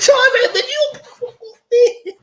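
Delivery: high, loud laughing squeal. Real, not acted. Viewer laughing while quoting Charles, high.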